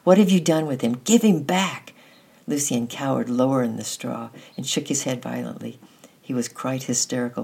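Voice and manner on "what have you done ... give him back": high, loud angry shouting; furious demand. A narrator's reading. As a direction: demand the boy back in fury. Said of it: "hissed Annette".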